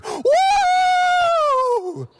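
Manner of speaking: piercingly high, long "woo"; mock runaway congregation cheer; imitated crowd whoop. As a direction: whoop loudly like a frenzied crowd. Imitating congregation running round church.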